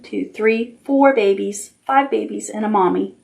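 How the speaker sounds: ordinary delivery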